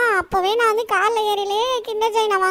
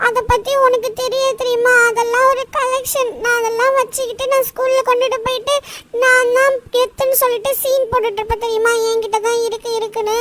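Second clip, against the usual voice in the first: acted crying. Boy explaining to his friend why he wants the toy, acted crying. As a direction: plead through hammed-up tears. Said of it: "அழுகாத Shinchan".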